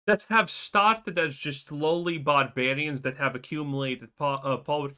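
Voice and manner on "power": usual speaking voice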